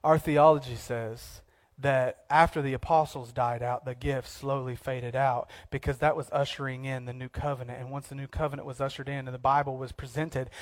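low, soft mock of a doubter; lightly voiced dismissive theologian; a hint of a dry, dismissive skeptic. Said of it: "And people will say".

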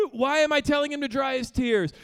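usual speaking voice

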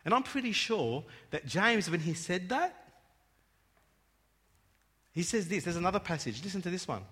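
usual speaking voice

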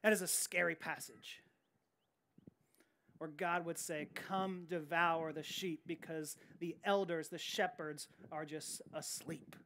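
a sobering hush after the prophecy; hushed and grave. Real, not acted. Warning about scary passage.

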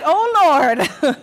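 imitating an overwhelmed kids' worker; mock dismay. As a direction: cry out in comic dread. Imitating Beth's dread at the number.

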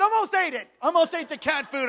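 high, agitated retold outburst; angry confession. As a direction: blurt it out in mock exasperation. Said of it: "I was totally angry".

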